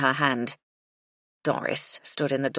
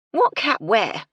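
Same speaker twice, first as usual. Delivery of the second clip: loud, sharp, higher demand; 6 semitones higher, 7 dB louder, slower than usual. A narrator's reading. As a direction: insist on an answer now. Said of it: "she demanded".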